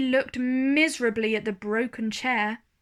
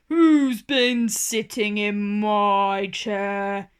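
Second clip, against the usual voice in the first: big bear voice; 2 semitones lower, 5 dB louder, slower than usual. A narrator's reading. Big bear asks about his chair, slow and louder. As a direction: rumble it slowly like the big bear. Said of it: "said the big bear".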